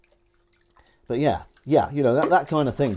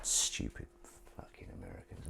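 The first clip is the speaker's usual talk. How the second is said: whispered mock insult, much softer; a joking whispered jab at Americans; 14 dB quieter than usual. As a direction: hiss the insult as a joke. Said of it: "I'm just joking".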